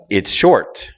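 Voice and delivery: "short" stressed high after a low "It's". Shown as a demonstration. Teacher showing learners low to high, stress on "short". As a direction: jump up on "short", then drop. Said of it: "notice how it goes from low to high".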